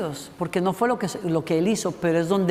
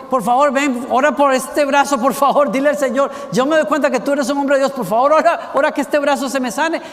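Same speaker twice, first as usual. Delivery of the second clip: high, loud pleading king begging for his arm; tearful plea; 5 semitones higher, 5 dB louder than usual. A narrator's reading. King begs the man of God to heal his arm, tearful and loud. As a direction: beg through tears, loud and high. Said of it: "Y llorando dijo".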